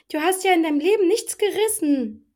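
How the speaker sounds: shrill-pitched theatrical put-down; over-emphatic imitation. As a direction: imitate her overdone emphasis way up high. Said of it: "Die betont echt alles so".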